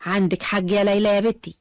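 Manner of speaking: plain reading voice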